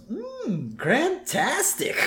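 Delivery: high, slow savoring squeak; squeaky kid tasting the juice. Retold or imitated. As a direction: relish the juice, drawn out. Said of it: "he licks his lips and goes".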